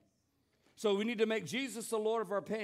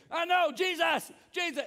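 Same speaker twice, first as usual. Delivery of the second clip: calling out; 9 semitones higher, 6 dB louder than usual. Retold or imitated. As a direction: keep crying out to Jesus. Beggar keeps calling out.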